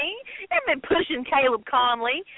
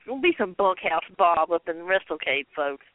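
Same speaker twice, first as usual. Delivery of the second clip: mock deep announcer; 9 semitones lower than usual. Mocking Bob's persona.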